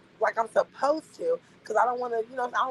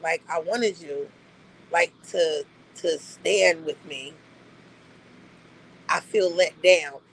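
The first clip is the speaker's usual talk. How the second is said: lower, slow, mock-wounded voice; mock hurt plea; 6 semitones lower, slower than usual. Mocking how the friend delivered the reprimand, in a low, slow, hurt tone. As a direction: imitate a friend sounding let down, pitched low. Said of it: "it was being delivered like a".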